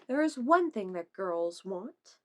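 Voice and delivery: usual speaking voice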